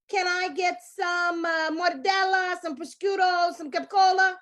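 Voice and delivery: mock Italian-American accent; Italian-American deli order. Customer ordering meats at the deli in an Italian-American accent. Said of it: "we say things like".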